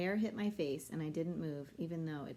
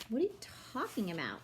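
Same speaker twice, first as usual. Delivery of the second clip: mocking mimicry; 5 semitones higher than usual. A narrator's reading. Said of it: "Alex mimicked".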